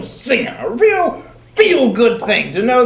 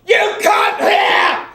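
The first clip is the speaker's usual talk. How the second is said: loud, slow, spelled-out shout; slow shouted repeat for a deaf father; 8 semitones higher, 3 dB louder, much slower than usual. Acted. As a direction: bellow it so the deaf dad hears.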